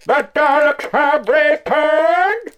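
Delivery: very high, slow, loud grating robot; Dalek voice. Pretend. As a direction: screech it out like a Dalek. Imitating a Dalek.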